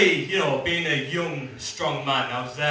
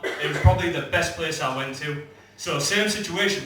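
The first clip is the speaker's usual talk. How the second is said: ordinary talk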